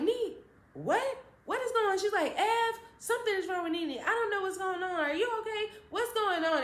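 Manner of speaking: imitating a frantic mother; panicked mom. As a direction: plead in a high panicked voice.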